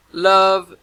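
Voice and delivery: lightly voiced southern drawl on "Love"; a hint of a southern accent, loud and high. A narrator's reading. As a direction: nurse calling him back, light southern accent. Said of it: "her southern accent in full force".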